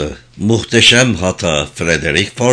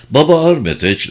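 plain reading voice

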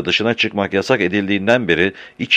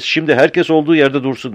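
mild narrated shout, pitched up; restrained, higher-pitched command; shouted order; 6 semitones higher than usual. A narrator's reading. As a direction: give a crowd an order in a raised reading voice. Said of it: "diye bağıran adam".

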